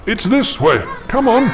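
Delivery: high pressing shout. Acted. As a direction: hurry her along. Leading her through the dark.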